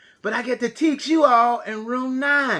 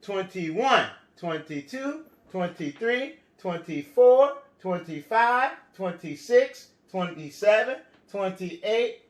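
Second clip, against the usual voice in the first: put-on reading to a toddler; slower than usual. Counting aloud for children.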